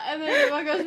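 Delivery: can barely get the words out for laughing; laughing while telling. Real, not acted. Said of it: "while crying".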